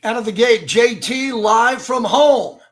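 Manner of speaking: loud, high, punchy show intro; big, high-energy live show open; show-opening announcer. Real, not acted. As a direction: launch the show at full voice, drawing out the words. Host opens his live show.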